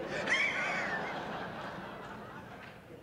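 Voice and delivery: high, breathy burst of laughter; big laugh. Real, not acted. Speaker laughing along with the crowd, high and breathy. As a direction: let the laugh spill out after the cheer. Audience delight after cheer.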